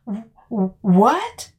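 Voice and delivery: caught-off-guard stammer on w-; flustered stammering denial; stuttering. Acted. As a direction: stumble over the w- before what. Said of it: "I stutter".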